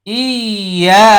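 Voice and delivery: ইয়া stretched out slowly with a rise; long held iya. Shown as a demonstration. Teacher demonstrating ইয়া slowly to students, long and drawn out. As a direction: draw ইয়া out long, rising at the end. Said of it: "লক্ষ্য করি সবাই".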